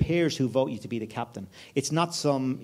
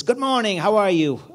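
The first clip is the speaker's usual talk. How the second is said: higher, over-friendly American hello; cheery American; 8 semitones higher, 6 dB louder than usual. Retold or imitated. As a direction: mimic a chipper early-morning American businessman. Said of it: "a very happy, typical American".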